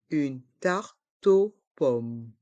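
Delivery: slow "Une tarte aux pommes", with gaps, T joined to aux; learner-paced, split "Une tarte aux pommes"; syllable by syllable. Shown as a demonstration. Teacher breaks phrase into syllables.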